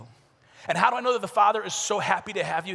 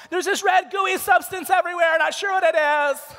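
high, loud mock damsel falsetto; hammed-up horror victim, drawn-out and high; horror movie girl; 11 semitones higher, 9 dB louder, slower than usual. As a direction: call out into an empty house as a clueless girl. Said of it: "girl, she's like".

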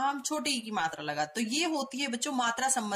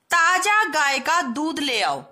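slowly and much louder, ताजा hit first; slow loud reading; 2 semitones higher, 8 dB louder, slower than usual. Shown as a demonstration. Teacher reading the wrong sentence aloud to children. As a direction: announce the wrong sentence loudly. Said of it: "ये वाक्य गलत है".

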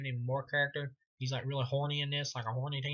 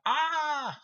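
yell; 14 semitones higher, 7 dB louder than usual. Retold or imitated. Acted shooting scene yell.

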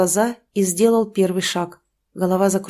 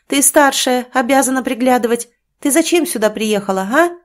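angry scolding; 4 semitones higher, 4 dB louder than usual. Acted. Mother berating daughter.

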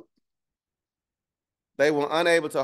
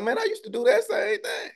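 mock envious bystander. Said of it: "Somebody was like".

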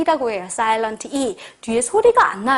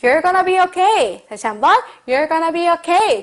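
slow and a bit higher, reassuring; slow reassuring model; 4 semitones higher, slower than usual. Shown as a demonstration. Teacher modelling comfort for students, slow. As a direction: reassure someone, slowly. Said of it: "한번 해볼게요".

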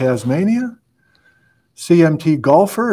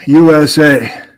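big booming broadcast opening, louder; TV announcer; 9 dB louder than usual. Acted. Host opening the livestream to viewers, booming announcer voice. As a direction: welcome them like a live TV anchor.

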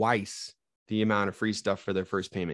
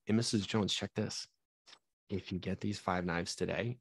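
lively pitch, voice going up and down, dropping softer on the hook; energetic sales pitch; 7 dB quieter than usual. Shown as a demonstration. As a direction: sell it with energy that rises and falls. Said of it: "do you guys hear the energy in my voice?".

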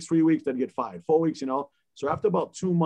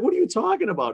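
shocked disbelief; 10 semitones higher, 4 dB louder, faster than usual. Retold or imitated. Retelling boss demanding name change.

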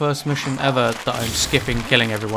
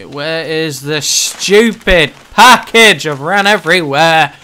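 frustrated yelling; 9 dB louder, slower than usual. Real, not acted. Frustrated search in game.

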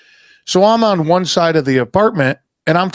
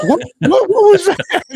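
very high-pitched mock shock; shocked callers. Imitating people on wife's call, high mock bewilderment. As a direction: squeal it like startled people overhearing something. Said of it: "and they're like".

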